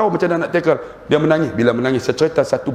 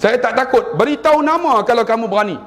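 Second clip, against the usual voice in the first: raised, daring retort to a threat; defiant reply; 9 semitones higher than usual. Retold or imitated. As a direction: challenge him to give his name. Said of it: "Saya kata". (cabaran berani dengan suara tinggi)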